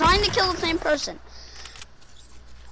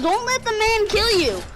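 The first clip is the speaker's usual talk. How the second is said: normal talking voice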